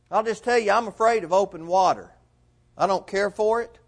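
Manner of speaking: ordinary talk, nothing marked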